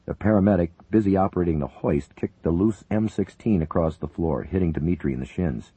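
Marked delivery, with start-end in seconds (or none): none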